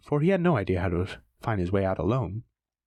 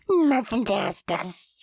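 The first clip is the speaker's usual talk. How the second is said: very high slow shriek; high shrieking Gollum; 10 semitones higher, much slower than usual. Acted. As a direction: shriek it at him. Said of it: "shrieked Gollum".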